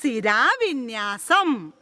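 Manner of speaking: സിരാവിന്യാസം spelled out slowly, syllable by syllable; slow, clear classroom model of സിരാവിന്യാസം; slow model word. Shown as a demonstration. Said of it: "ശബ്ദത്തിൽ പറ". (സിരാവിന്യാസം സാവധാനം)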